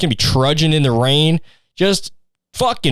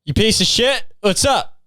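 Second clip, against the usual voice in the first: cheery insults; 6 semitones higher than usual. Acted. Voicing cheerful family insults.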